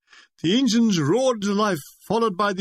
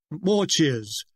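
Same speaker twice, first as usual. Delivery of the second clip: plain reading voice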